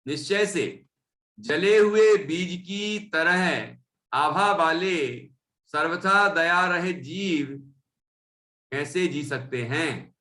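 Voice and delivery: slow, measured recitation; scripture reading. Acted. Teacher reading a verse about compassionless beings, slow recitation. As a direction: read out the text slowly, phrase by phrase. Reads verse meaning aloud.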